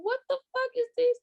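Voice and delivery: high, incredulous mock outburst; mock outraged disbelief. Reading Twitter takes, high incredulous mock outrage. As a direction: act out reading dumb tweets in shock. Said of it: "I'm like".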